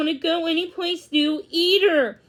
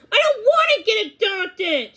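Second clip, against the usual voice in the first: high, loud sudden confession; blurted out; 8 semitones higher, 5 dB louder than usual. Acted. Daisy confessing to Millie, blurted out high and loud. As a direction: burst it out at last. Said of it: "she finally blurted out".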